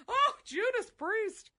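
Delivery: high, drawn-out exasperated grandma exclamation; imitating grandma. Showing cohost what grandma would say, high drawn-out exclamation. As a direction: voice grandma's slow, high-pitched oath. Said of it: "That's what she would say".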